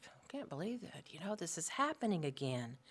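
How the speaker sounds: inner murmuring. Retold or imitated. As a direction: grumble it under your breath. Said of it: "That inner murmuring".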